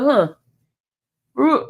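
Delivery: ordinary talk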